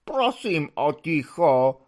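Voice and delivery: slow, drained plea; despairing voice. Acted. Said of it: "řekl zoufalým hlasem".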